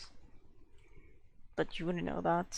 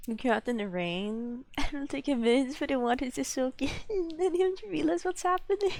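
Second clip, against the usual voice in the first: laughing; 6 semitones higher, 4 dB louder than usual. Real, not acted. Laughing at imagined wet cat.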